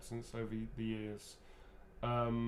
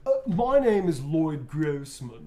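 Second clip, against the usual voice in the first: put-on loud, drawn-out transatlantic accent; high, slow transatlantic presenter voice; Lloyd Grossman; 4 semitones higher, 12 dB louder, slower than usual. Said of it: "He talks like that".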